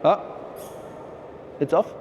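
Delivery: usual speaking voice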